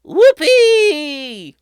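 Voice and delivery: high, loud delighted cry; joyful whoop. A narrator's reading. Mrs Jenkins doing a backflip, high and joyful. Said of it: "Missus Jenkins shouted".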